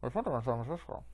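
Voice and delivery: fast, muffled joke take; comically muffled guard; muffled helmet voice. Pretend. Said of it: "I should be holding a popkin".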